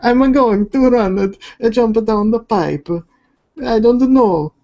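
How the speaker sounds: high-pitched cartoon Italian accent; hammed-up Italian accent. Streamer answers teasing about sounding Italian, high mock Italian accent. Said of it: "You talk like an Italian mobster".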